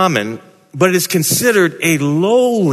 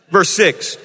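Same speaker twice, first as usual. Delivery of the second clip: ordinary talk, nothing marked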